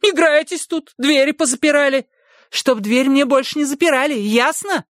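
high, scolding woman through the door; a stern housekeeper demanding entry; bossy housekeeper voice. Acted. Essie scolding. (голос сердитой домработницы)

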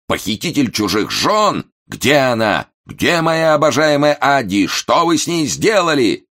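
high, loud accusing outcry; furious shout. A narrator's reading. Momus accuses the valet of stealing his wife, high furious shout. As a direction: hurl the accusation, rising to a scream. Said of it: "заорал Момус".

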